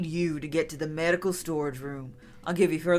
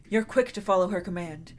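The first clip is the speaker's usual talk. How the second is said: normal talking voice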